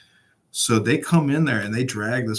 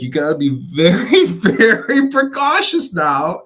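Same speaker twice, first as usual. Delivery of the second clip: louder and much higher, slow, laughing through it; 8 semitones higher, 9 dB louder, slower than usual. Real, not acted. Ranting to a friend about cameras, laughing. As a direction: break into laughter mid-sentence.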